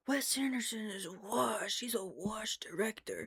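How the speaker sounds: soft, whispery imitation of naysayers; put-on whispered complaint; mocking critics. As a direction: mimic them in a hushed mock voice. Mimics people criticizing the director.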